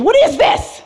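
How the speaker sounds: squeaky laughing disgust at the licorice liqueur; squealing disgust. Retold or imitated. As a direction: push the repeat up into a squeaky laughing shriek. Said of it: "and I'm like".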